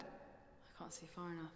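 slow whisper. Acted. Peering ahead on the river.

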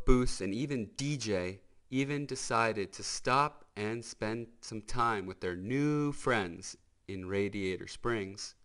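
plain reading voice

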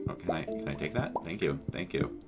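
normal talking voice

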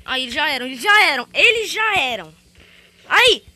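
loud, rising triumphant yells; triumphant shout. Real, not acted. Gamer shouting in triumph as the enemies are eliminated. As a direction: crow over the beaten enemies. Winning the round.

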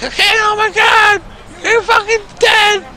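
high, loud furious mother screaming threats; shrill mock mother's tirade; shrieking angry mom. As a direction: scream it like a mom who just found out. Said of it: "That's what she would've said".

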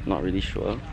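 usual speaking voice

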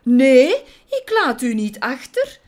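a loud, brave no; brave shout. A narrator's reading. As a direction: shout it out, refusing to leave her. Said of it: "schreeuwde Willy dapper".

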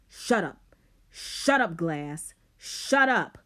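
loud, raw scream; raw strangled scream. A narrator's reading. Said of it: "Rob's voice was raw and strangled".

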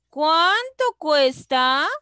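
pregunta lenta, CUÁNTO agudo y fuerte, CUESTA subiendo. Shown as a demonstration. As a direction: recalca cada palabra y levanta «cuesta». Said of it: "estoy preguntando". (pregunta recalcada, aguda y fuerte)

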